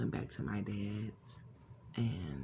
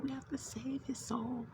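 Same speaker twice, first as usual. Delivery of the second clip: urgent whisper; 13 semitones higher than usual. Retold or imitated. Frantic vision, pleading to the Lord.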